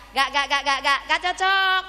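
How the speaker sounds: loud, high clucks turned into words; screaming hen. Acted. Hen protesting to God, screaming in clucks. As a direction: cackle the words, stretching the last one. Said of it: "Maka dia teriak".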